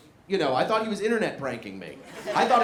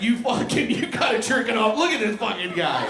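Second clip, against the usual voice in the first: mock therapist; 5 semitones higher, 4 dB louder than usual. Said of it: "he was like".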